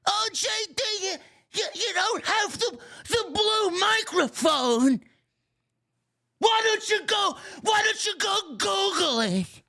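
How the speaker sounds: high, loud mocking whine; mocking viewer. Streamer mocking a viewer who nags him, high and loud. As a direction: sneer in a high nagging voice. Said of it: "mocking chat viewers".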